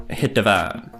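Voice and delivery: usual speaking voice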